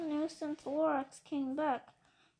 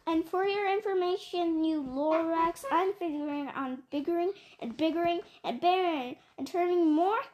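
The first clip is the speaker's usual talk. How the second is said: angry yell; 5 dB louder than usual. Acted. Once-ler yells back at the Lorax, loud and defiant. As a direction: shout him down, rising in defiance. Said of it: "Then I got mad, terribly mad. I yelled at the Lorax".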